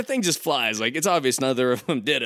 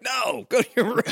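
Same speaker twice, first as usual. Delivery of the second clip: parent voice; 12 semitones higher than usual. Retold or imitated. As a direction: bark the order like a put-upon mom. Parent refusing the kids.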